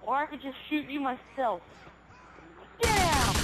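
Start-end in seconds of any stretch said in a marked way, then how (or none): 2.8-3.4 loud barked command; officer shout. Acted. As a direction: yell it at him. Officer yells at suspect.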